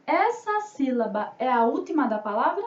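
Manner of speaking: ordinary delivery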